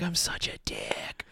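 hushed unvoiced whisper. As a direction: whisper it to yourself. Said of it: "I'm like".

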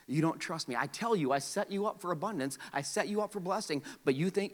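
normal talking voice

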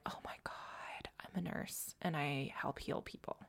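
whispered realization, hushed and moved; awed whisper. Retold or imitated. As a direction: breathe it out like a quiet revelation. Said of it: "to be like".

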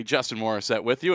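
normal talking voice

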